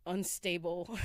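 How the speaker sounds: laughing. Real, not acted. Laughs at own word choice.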